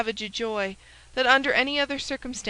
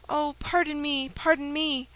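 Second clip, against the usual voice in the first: higher, begging apology; pleading cry; 5 semitones higher than usual. Acted. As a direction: plead for forgiveness warmly. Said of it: "she cried".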